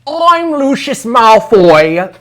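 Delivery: slow, high, loud mock British accent; over-the-top British accent; British accent attempt. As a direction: ham up a posh British villain accent. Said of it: "Should I try it with an accent?".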